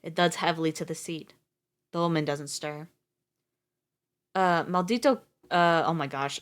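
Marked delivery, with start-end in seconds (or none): none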